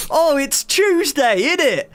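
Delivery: high, slow mock British accent, American trying it; a cliche British accent faked by an American; American doing British. Streamer mocks Americans imitating British, high mock British accent. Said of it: "you'll get American people go".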